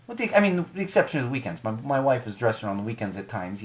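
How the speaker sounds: ordinary talk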